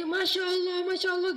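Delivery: excited exclamation. Retold or imitated. Ojek driver recognizing the preacher, thrilled and loud. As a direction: gasp it out like meeting your hero.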